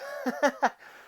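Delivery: short, high chuckle; real chuckle. Real, not acted. Host correcting how many years it has been, chuckling. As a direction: laugh at your own slip.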